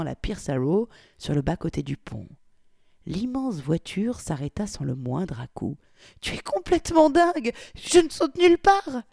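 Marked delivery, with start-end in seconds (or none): 6.2-9.0 very high, loud frightened cry; panicked protest. Acted. Victoria refuses to jump, high panicked cry. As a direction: shriek your refusal.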